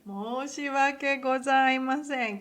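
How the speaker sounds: loud ceremonious apology; formal apology. Shown as a demonstration. Teacher repeating the formal apology, loud and ceremonious. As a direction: deliver it with full formality. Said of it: "what I said in a formal way".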